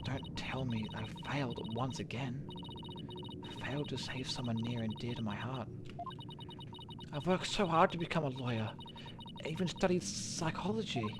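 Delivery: soft, sinking voice of a lawyer who feels she failed; quiet despairing lawyer. Acted. Athena doubting herself after the mock trial, quiet and despairing. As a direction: let it drain out of her, hushed.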